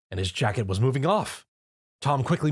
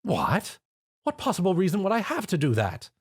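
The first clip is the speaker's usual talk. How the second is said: high indignant disbelief; shocked; 8 semitones higher than usual. Acted. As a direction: recoil at the idea. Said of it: "Rarity recoiled".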